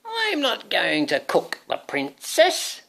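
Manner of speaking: high, slow witch squeal; hammed-up squeaky witch. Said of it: "squealed the witch".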